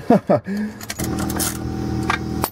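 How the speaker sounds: quick laugh under the car; short nervous laugh. Real, not acted. Laugh after joke.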